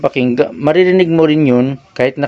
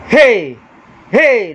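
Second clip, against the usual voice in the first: shout; 8 semitones higher than usual. Shown as a demonstration. Sound tech shouting to push the line past orange. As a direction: belt out a hey. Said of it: "Sisigaw ako".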